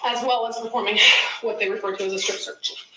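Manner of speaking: usual speaking voice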